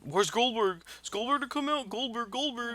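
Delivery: high-pitched imitation of someone calling out; hammed-up yelling for someone; mock yelling. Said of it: "he kept yelling".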